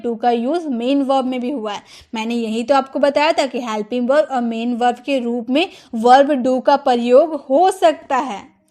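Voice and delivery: ordinary talk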